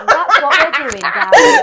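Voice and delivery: loud, high words breaking into laughter; laughing hard. Real, not acted. Host joking to listeners about annoying everyone, laughing hard. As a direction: shout it through helpless real laughter. Both hosts laughing at own joke.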